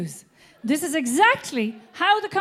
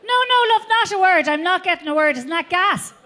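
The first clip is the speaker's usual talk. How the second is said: put-on high, loud elderly mum; old Irish mother; 4 semitones higher, 4 dB louder than usual. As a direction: play the chatty deaf mother. Mother voiced on phone.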